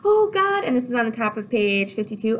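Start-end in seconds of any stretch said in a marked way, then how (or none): 0.0-0.6 high, loud, giddy outburst; excited Juliet. Acted. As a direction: burst out with girlish anticipation. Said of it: "Juliet's all excited".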